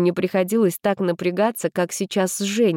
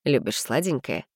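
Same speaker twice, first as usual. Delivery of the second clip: lower, softer, teasing guy; playful young man; 5 semitones lower, 4 dB quieter than usual. A narrator's reading. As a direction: tease her lightly in a low voice. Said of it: "игриво поинтересовался парень".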